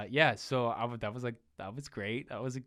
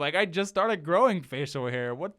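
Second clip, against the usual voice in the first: higher, louder, what-do-you-want exasperation; exasperated; 9 semitones higher, 8 dB louder than usual. Real, not acted. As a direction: throw up your hands, voice climbing. Exasperated retelling.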